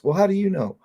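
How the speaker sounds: a lightly voiced doubting mom; skeptical mom. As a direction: daughter imitating mother, skeptical. Mother voiced in story.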